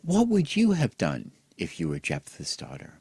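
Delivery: usual speaking voice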